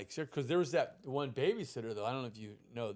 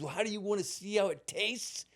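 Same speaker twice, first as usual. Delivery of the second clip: higher-pitched imitated threat; mock menacing taunt; 6 semitones higher than usual. Boyfriend threatens boy he caught looking, higher taunting voice. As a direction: build the bully's dare into a threat.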